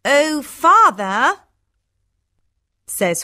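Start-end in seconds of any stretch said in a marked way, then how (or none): 0.0-1.4 high, slow, coaxing daughter voice; wheedling Hortensia. Acted. Daughter wants presents from father, wheedling. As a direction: draw it out to coax him.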